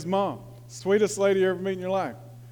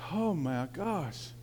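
dismayed; 2 semitones lower, 10 dB quieter, much slower than usual. Retold or imitated. Dismayed by the harsh churchgoers.

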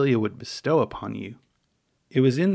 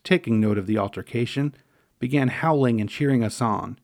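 plain reading voice

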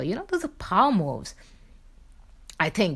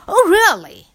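high, loud, exaggerated "oh really"; hammed-up disbelief, much higher and louder; mock surprise; 8 semitones higher, 7 dB louder, slower than usual. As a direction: ham up a fake shocked reaction. Mock reaction to being corrected.

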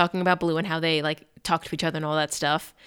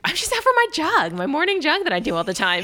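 high, breezy innocent cover story; fake casual; 9 semitones higher than usual. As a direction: play it oh-so-casual and fool nobody. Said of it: "He's like".

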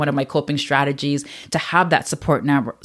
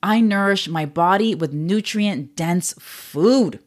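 slow, deliberate affirmation; slow mantra recital; much slower than usual. Shown as a demonstration. Offering listeners a mantra, slow and deliberate. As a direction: lay each word down slowly. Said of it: "Another one could be".

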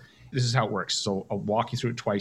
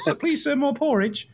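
very high-pitched little English beggar boy, hammed-up accent; bad English accent; slower than usual. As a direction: plead for food in a terrible put-on English accent. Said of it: "[name], that's a terrible accent".

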